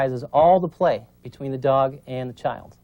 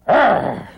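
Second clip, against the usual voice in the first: sharp mock growl, high and loud; imitating a mother dog's warning growl; 6 semitones higher, 7 dB louder than usual. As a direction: growl like a mother dog correcting. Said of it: "the word that mother dog uses is she says".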